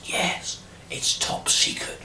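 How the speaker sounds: whisper. Retold or imitated. MP confides top secret leak.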